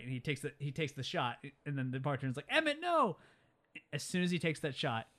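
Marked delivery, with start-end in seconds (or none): 2.5-3.2 high-pitched imitated cry of alarm; warning cry. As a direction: cry out high to stop him, playacting. Bartender cries out to stop Emmett.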